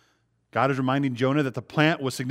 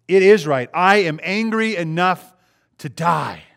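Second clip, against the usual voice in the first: much louder and higher, slow sulky outburst on angry enough; toddler temper tantrum; 5 semitones higher, 9 dB louder, slower than usual. Retold or imitated. Preacher voicing Jonah answering God, in a petulant angry outburst. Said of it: "shows his little toddler temper tantrum".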